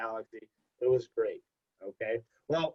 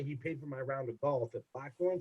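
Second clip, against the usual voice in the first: ordinary talk, nothing marked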